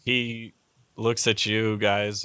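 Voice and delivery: ordinary talk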